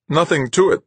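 trying to sound brave. A narrator's reading. Passenger answering Marguerite's smile, trying to sound brave. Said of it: "trying to sound brave".

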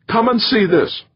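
high-pitched eager call. A narrator's reading. Young digger hailing his boss to the grave, calling out. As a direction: call him over in a high voice.

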